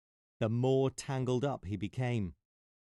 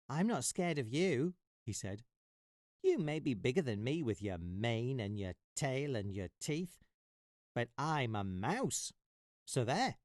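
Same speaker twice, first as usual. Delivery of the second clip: lightly voiced defiant mouse.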